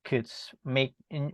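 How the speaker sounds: ordinary delivery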